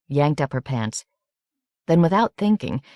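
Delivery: usual speaking voice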